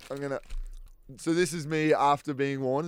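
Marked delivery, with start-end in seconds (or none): 0.1-0.4 quiet. Shown as a demonstration. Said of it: "how quiet I can".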